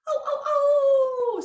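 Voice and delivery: high drawn-out coyote howl. Shown as a demonstration. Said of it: "what does a coyote say?".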